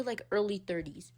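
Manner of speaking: ordinary talk, nothing marked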